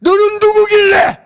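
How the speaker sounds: God demanding an answer, forceful; loud challenge. Retold or imitated. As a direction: thunder the question out. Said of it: "이 물음이야".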